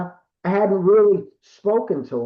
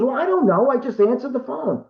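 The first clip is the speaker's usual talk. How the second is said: put-on higher-pitched quoted girlfriend; retold defensive reply; 8 semitones higher than usual. Girlfriend answers him about the phone call, retold defensive reply. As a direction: answer higher, as if not knowing.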